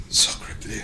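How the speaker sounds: hushed mock-French gasp; whispered French exclamation. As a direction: breathe it out like a startled French dog. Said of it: "the dog is like".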